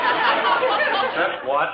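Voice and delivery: high, slow and loud in disbelief; startled cry. Acted. Father yelps in disbelief at son's car request, startled. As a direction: blurt it out, can't believe your ears.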